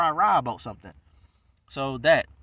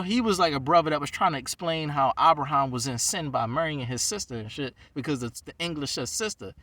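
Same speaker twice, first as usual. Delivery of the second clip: ordinary talk, nothing marked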